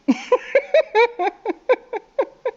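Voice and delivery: high, loud staccato giggle; confused laugh. Real, not acted. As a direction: burst into a rapid string of high giggles.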